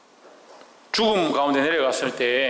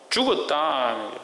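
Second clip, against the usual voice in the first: usual speaking voice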